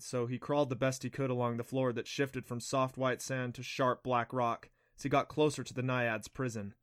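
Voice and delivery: usual speaking voice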